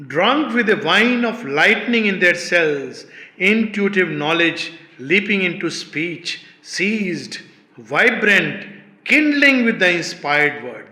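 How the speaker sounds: loud, declaimed poetry with drawn-out words; emphatic verse recitation. Acted. Teacher rereading mystic verse to listeners, loud declamation. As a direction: declaim each phrase with force.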